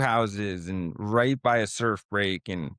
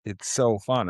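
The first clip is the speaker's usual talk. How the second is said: ordinary delivery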